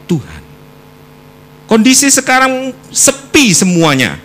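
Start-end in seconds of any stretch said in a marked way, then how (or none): 1.7-4.1 loud proclamation. Real, not acted. Preacher raises voice on point.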